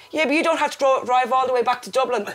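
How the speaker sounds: high, loud comic protest; imitating a father's loud grievance; mock aggrieved dad. Dad facing long drive with crying mum, mock aggrieved.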